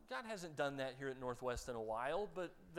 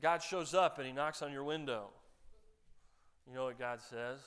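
normal talking voice

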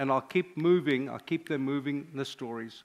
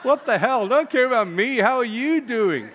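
higher, louder, laughing at the absurdity; a father's loving what-the-hell, half laughing; exasperated outburst; 8 semitones higher, 8 dB louder than usual. Retold or imitated. Father at the delivery bed, loud, incredulous and laughing. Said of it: "I'm like".

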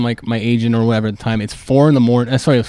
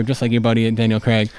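ordinary talk

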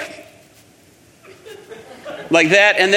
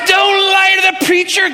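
high-pitched holler of an indignant elder, imitated; mock scolding shout; 11 semitones higher, 3 dB louder than usual. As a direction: act out a scandalised telling-off. Elder scolds the young man.

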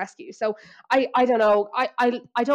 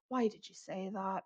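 hushed disbelief; 2 semitones lower, 13 dB quieter than usual. Retold or imitated. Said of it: "where I'm like".

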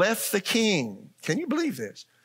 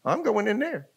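lightly acted, higher reply; mock confident newcomer; 6 semitones higher than usual. Newcomer answering the angel at the gate, lightly voiced confidence. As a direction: answer the gatekeeper in a slightly put-on higher voice.

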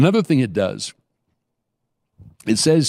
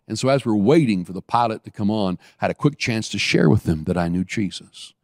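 ordinary delivery

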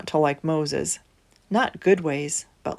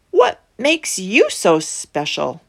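scornful quote; 5 dB louder than usual. Acted. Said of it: "they thought".

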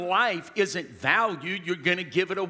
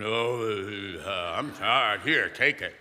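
low, weary mock groan of a tired man; 7 semitones lower, slower than usual. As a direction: play a worn-out man giving up. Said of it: "Oh, the men are from".

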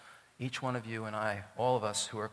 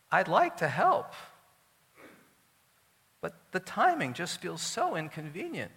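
mock-apologetic, raised-pitch Esther; reluctant excuse; 5 semitones higher than usual. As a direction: beg off sweetly with a flimsy excuse. Esther voiced making a reluctant excuse.

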